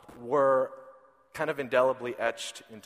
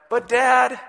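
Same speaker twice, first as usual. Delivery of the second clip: put-on much higher, louder little-boy plea; whiny toddler; 9 semitones higher, 11 dB louder than usual. Father imitating his son asking for lunch, high whine. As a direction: nag for lunch like a small child.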